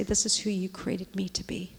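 soft, hushed self-talk into a whisper; whispered to herself. Retold or imitated. Woman whispering to herself before the mirror, softly. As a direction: murmur it to yourself in the mirror. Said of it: "I look in the mirror and I say".

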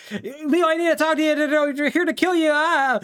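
high, urgent and out of breath; panicked warning. Retold or imitated. Podcaster voicing Vito rushing to warn Leo, high panic.

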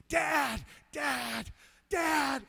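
high, loud calls to Dad; shouting. Retold or imitated. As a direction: call out excitedly to a father far off. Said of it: "I'm shouting".

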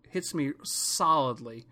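ordinary talk, nothing marked

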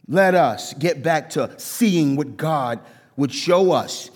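normal talking voice

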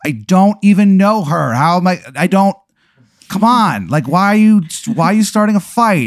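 high, loud defensive protest; driver to his crow. Acted. The driver protesting to his staring crow, high and loud. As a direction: snap back at the crow. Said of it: "you can still hear him be like".

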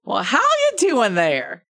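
high, loud, drawn-out tavern greeting; bad-accent barkeep. Barkeep welcoming the party at the bar, hammed-up accent. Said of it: "only do one version of this accent".

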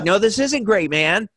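high, loud retort; retold protest. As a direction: push back in exasperation, pitched well up. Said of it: "and I'm like".

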